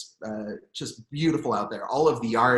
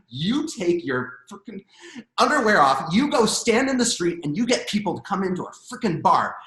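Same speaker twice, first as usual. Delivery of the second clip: bossy order; 6 semitones higher, 5 dB louder than usual. Retold or imitated. Bartender to the man in underwear, a bossy scold. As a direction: order him out, stern. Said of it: "And she goes".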